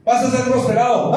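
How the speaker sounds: high, punchy prophetic promise; prosperity prophet. Acted. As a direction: proclaim blessings like a hype preacher. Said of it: "cuando da una palabra profética".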